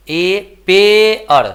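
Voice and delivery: पेअर slow and loud, पे held long; split pronunciation. Shown as a demonstration. Teacher shows both vowels in the word, split pronunciation. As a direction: pull पेअर apart into पे and अर. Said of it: "तो क्या आया".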